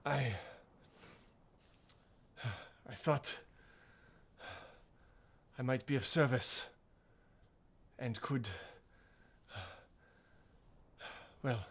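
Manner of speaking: lightly voiced older woman, long pauses between phrases; hesitant older woman. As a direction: haltingly offer help, lightly in character.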